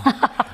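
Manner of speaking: brief laugh at your own dry quip; amused laugh. Real, not acted.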